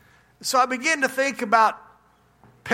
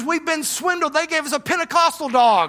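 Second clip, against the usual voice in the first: high, hammed-up shock at being cheated; pastor's wife, realizing they were swindled, in mock shock; mock dismay; 6 semitones higher, 4 dB louder than usual. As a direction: play the punchline as comic outrage. Punchline, couple's dismay.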